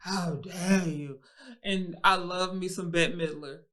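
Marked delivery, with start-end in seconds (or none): 0.0-1.2 mock outrage. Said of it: "Everybody's like".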